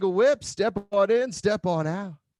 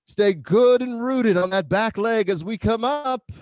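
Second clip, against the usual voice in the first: ordinary talk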